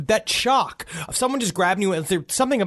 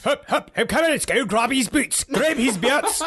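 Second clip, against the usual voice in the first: bustling medic; 7 semitones higher than usual. Pretend. Voicing old-timey EMTs.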